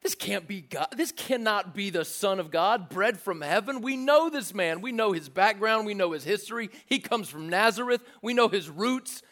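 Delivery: mild mock disbelief from the neighbors; dismissive crowd. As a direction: wave him off, we know this guy. Voicing the grumbling crowd.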